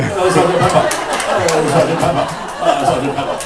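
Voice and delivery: loud, looping rock-star caricature. Retold or imitated. Rock singer who would never stop, loud droning caricature. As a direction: blare it over and over, endlessly stuck. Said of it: "he would still be going".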